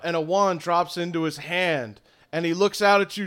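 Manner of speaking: usual speaking voice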